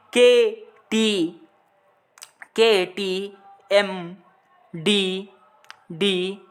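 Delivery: very slow, drawn-out letters के टी एम; slow spelled mnemonic. Shown as a demonstration. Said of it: "आप इस तरह भी याद कर सकते हैं". (के टी एम को बहुत धीरे, एक-एक अक्षर खींचकर बोलना)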